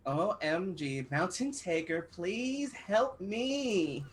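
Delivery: put-on slow pleading Valley Girl accent. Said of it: "Valley Girl accent".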